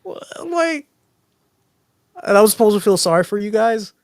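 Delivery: worked-up disbelief; incredulous rant. Real, not acted. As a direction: rant about it, amazed. Ranting about the commercial.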